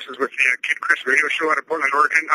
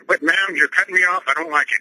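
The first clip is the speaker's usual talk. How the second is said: usual speaking voice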